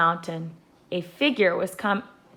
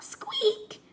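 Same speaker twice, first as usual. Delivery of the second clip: tiny squeak, much higher and softer; mouse squeak; 5 dB quieter than usual. A narrator's reading. Peanut the mouse squeaking behind the rock, tiny and high. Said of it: "said the mouse".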